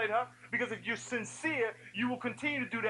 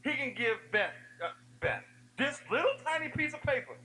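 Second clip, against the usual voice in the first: normal talking voice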